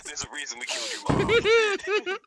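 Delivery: laughing through the line; cracking up mid-line. Acted.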